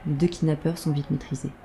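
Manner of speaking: normal talking voice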